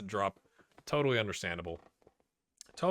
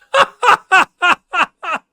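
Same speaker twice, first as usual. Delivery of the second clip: high, loud burst of laughter; 18 dB louder than usual. Real, not acted. Laughing at his team's blunder.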